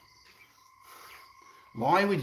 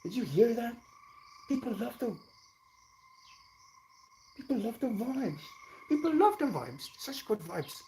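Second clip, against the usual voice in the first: much higher cutesy voice, soft; squeaky pet voice; 9 semitones higher, 4 dB quieter than usual. Pretend. Streamer voicing the puppy reacting to chat, high cutesy voice. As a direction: chirp it like the puppy talking.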